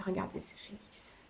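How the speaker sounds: soft awed whisper. Real, not acted. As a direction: hush and lean in to show the card. Admiring the phoenix card.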